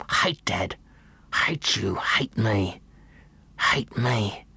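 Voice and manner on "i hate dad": usual speaking voice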